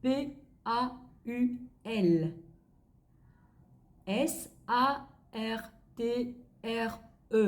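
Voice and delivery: slow French letter names, spaced apart; slow spelling. Shown as a demonstration. Teacher dictating French names to learners, slow letter by letter. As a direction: spell it out slowly, pausing after each letter. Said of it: "Chị sẽ đọc chậm thôi ạ".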